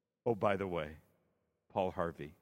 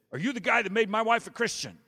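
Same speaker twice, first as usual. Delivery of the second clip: loud, higher gruff man's demand; 12 semitones higher, 9 dB louder than usual. Retold or imitated. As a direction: bark it like a big angry husband. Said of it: "this gruff voice said".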